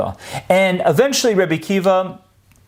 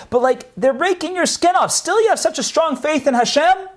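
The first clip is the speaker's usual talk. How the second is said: incredulous protest; 10 semitones higher than usual. Acted. Students protest at the execution.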